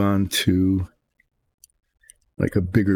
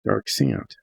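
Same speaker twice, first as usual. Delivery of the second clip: whispered mutter under breath; muttering to self. Real, not acted. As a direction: mumble it to yourself, barely voiced. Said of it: "I'm muttering to myself".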